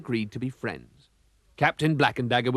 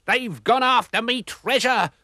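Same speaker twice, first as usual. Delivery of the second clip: high, slow, loud pirate wail; 11 semitones higher, 5 dB louder than usual. A narrator's reading. Pirate captain wails over stolen treasure, high slow wail. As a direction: lament the lost loot.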